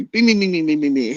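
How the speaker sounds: rapid bing punches, high then fading; punch sound effects. Retold or imitated. Teller acting out hitting the rival, punches fading. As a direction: mimic a flurry of punches.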